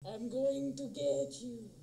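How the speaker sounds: low, soft, slow playful threat; mock menace. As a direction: draw it out quietly like a pretend stalker. Playful threat after the chant.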